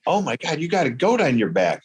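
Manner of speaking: imitating a stunned hunter on the trail; retold amazement. Passing hunter sees the goat, mock-amazed.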